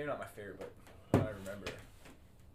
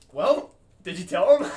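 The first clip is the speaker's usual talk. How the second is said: louder, higher and slow; shouted retort; 10 semitones higher, 20 dB louder than usual. Retold or imitated. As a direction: yell it back across the field. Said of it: "He's like".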